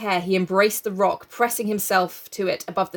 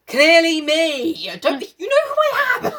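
exasperated Ralph; 10 semitones higher, 6 dB louder than usual. Retold or imitated. Ralph calling up to Roger, mock exasperated. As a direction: stretch it out like a put-upon schoolboy. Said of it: "And Ralph is like".

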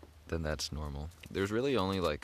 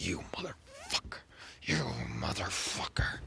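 whispered, high-pitched mock insult; mirror acting; 4 semitones higher, 3 dB quieter than usual. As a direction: hiss it at your reflection. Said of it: "pretend act in the mirror".

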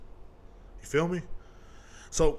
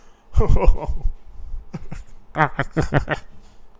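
laugh; 3 semitones higher, 6 dB louder than usual. Real, not acted. Laughing at his own mockery.

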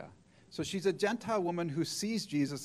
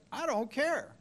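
defiant; 10 semitones higher, slower than usual. Retold or imitated. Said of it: "she's like".

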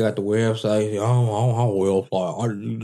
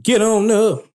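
loud playful call; 10 semitones higher, 5 dB louder than usual. Acted. Playful send-off.

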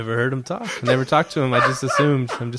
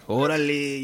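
lightly put-on Mexican accent; playful, mild Mexican accent imitation; mock Mexican accent; 2 semitones higher, slower than usual. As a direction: tease with a touch of Mexican-accented slang. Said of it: "make fun of how Mexicans talk".

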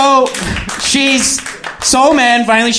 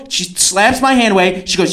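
ordinary delivery

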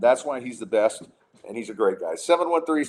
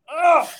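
loud, high anguished ugh; groan of disgust; 10 dB louder than usual. Real, not acted. Fed up with copied alien plots, a loud high groan. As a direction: let out a pained, fed-up groan. Frustrated groan after rant.

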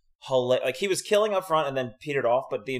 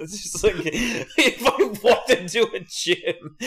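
laughing; 8 semitones higher, much slower than usual. Real, not acted. Laughing through the line.

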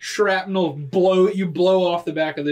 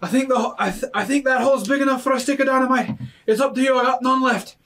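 higher, louder, fast character voice; NPC voice; 9 semitones higher, 4 dB louder, faster than usual. Acted. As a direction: rattle it off as the brash sailor.

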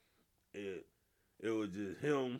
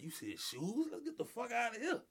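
mock scared; 11 semitones higher than usual. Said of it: "be like".

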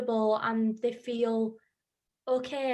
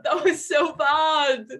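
laughing mock outrage; 4 semitones higher, 8 dB louder, slower than usual. Real, not acted. Teasing over a bad accent.

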